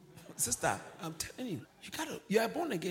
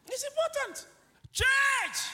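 high, loud church call; high-pitched preacher's shout; urgent shout to congregation; 8 dB louder, slower than usual. Real, not acted. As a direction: cry out to rouse the congregation. Calling the congregation to attention.

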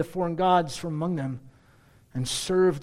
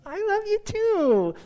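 very high, slow, melting reply; wife answering her husband, very high and sweet; 14 semitones higher, much slower than usual. Pretend. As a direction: coo it back like a smitten wife. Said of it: "She'd say".

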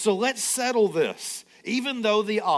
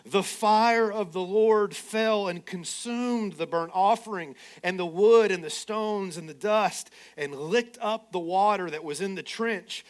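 usual speaking voice

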